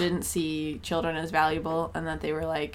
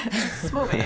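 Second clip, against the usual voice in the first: laughing; 7 semitones higher than usual. Pretend. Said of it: "Whisper about how we loved this movie".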